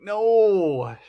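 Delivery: a sudden high, loud, mock-grief cry of No; anguished no. Viewer reacting to the wife's death, loud mock-anguished No. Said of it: "and you're like".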